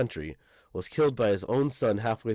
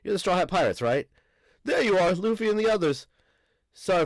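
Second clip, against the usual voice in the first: much higher and louder giant teacher voice; teacher's voice redone; 8 semitones higher, 4 dB louder than usual. Acted. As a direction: redo the teacher so she stands apart, high and bright. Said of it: "I can't tell who's fucking talking".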